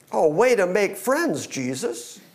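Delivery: normal talking voice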